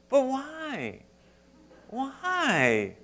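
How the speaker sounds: put-on high whiny kid pleading; whiny child voice. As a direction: whine like a little kid. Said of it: "You often get a whiny little voice".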